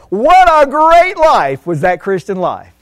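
put-on voice mocking the wrong words; mock wrong praise. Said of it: "we're not gonna be saying".

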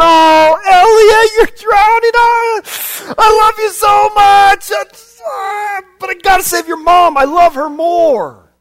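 high, loud, crying shout of a desperate father; hammed-up tearful goodbye to a drowning daughter; dramatic farewell. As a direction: wail it like a man choosing in panic. Acts father saying goodbye drowning.

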